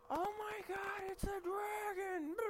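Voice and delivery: high, frantic shriek of panic; panicked shout. Pretend. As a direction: scream it like a terrified villager. Imagined panicked villager.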